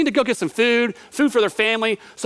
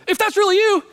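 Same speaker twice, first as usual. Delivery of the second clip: nervous Peter; 10 semitones higher, 3 dB louder, faster than usual. Retold or imitated. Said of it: "So Peter just is like".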